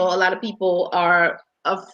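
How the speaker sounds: ordinary delivery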